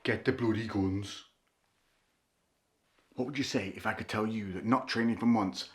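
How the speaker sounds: normal talking voice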